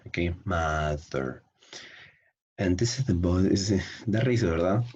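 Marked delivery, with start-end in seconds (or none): 0.5-1.4 "Mother" drawn out as MA-ther; open-vowel pronunciation. Shown as a demonstration. Teacher repeats the model for students, "Mother" as MA-ther. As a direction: say "Mother" with a wide ah, slowly. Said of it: "Something like this".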